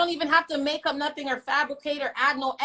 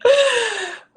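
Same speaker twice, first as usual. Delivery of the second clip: laughing; 10 semitones higher, 6 dB louder than usual. Real, not acted. Bursts out laughing.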